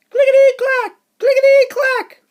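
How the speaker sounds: high, loud sing-song clickety clack; a high loud train chant for the kids; train sound effect. Acted.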